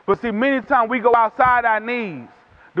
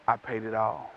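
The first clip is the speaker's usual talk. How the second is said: much lower and softer, solemn and steady; quiet pleading advocate; 12 dB quieter than usual. Acted. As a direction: say it low like a final verdict of love. Advocate pleading before God.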